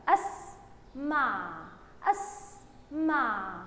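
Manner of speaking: أسمع very slowly in two parts, أس then مع; slow syllabic reading. Shown as a demonstration. Teacher reading along with young pupils, slow and syllable by syllable. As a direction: draw out أسمع, pausing after أس. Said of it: "بنطقه مع الحرف اللي قبليه".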